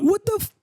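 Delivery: high "what the f-", cut on the f; bitten-off curse. Retold or imitated. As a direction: stop "what the f-" on the f sound. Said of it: "I just say the, the F part".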